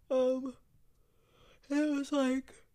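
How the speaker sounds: talking through a yawn; words stretched by a real yawn. Real, not acted. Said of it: "I don't know why I'm yawning".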